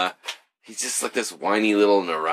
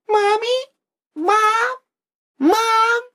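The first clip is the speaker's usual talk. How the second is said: put-on high, louder calling like a scared child; mock a whiny little boy calling mom; whiny kid voice; 6 dB louder, much slower than usual. Mocking the scared kid calling his mom in a high whine.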